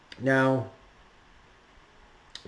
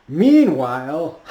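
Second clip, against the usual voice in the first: slow, loud announcer lead-in; drawn-out loud announcer "meanwhile"; dramatic narrator; 3 semitones higher, 9 dB louder, slower than usual. Pretend. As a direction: stretch it out like a cartoon narrator.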